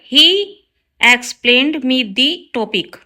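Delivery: slow, careful read of the wrong sentence; slow teacher dictation. Shown as a demonstration. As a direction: spell it out word by word for the class. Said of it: "wrong है sentence".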